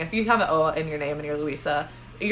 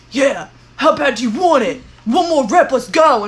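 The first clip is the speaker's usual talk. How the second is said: loud, higher pumped-up coach; gym hype voice; 5 semitones higher, 7 dB louder than usual. Retold or imitated. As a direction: hype yourself up for one more rep. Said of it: "like, encouraging voice".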